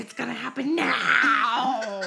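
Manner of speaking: loud, slow straining cry; strained shout. Retold or imitated. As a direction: push it out with effort. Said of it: "Was like".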